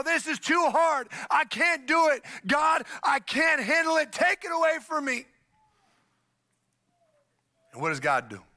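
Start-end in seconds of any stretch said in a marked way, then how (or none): 0.0-5.2 whining prayer. Retold or imitated. Believers in a hard season, wailing at God in mock despair. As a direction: cry out that it is all too much. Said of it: "and we go".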